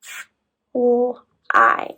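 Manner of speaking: "f, l, I" as isolated sounds. Shown as a demonstration. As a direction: sound out each letter of "fly": "f, l, I". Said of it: "So the last part is".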